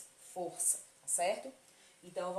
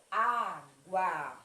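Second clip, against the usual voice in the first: água slowly, with a loud, long "á"; stress on first syllable; 8 dB louder than usual. Shown as a demonstration. Teacher showing pupils a paroxytone, stress on the "á" of água. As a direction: draw out and punch the start of água. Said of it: "qual foi a sílaba mais forte?".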